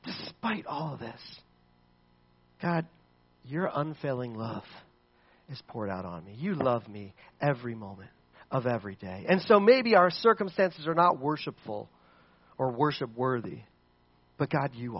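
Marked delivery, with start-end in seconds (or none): 0.0-9.2 hushed confession of love; hushed devotion. Acted. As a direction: drop into a quiet trusting prayer. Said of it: "and he says".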